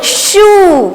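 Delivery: शू with a long, held "oo"; syllable demo. Shown as a demonstration. Teacher answering how it is read, शू drawn out. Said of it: "इसको क्या पढ़ेंगे".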